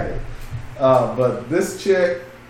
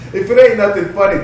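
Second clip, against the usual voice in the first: laughing; 9 semitones higher, 8 dB louder than usual. Real, not acted. Laughing restart of line.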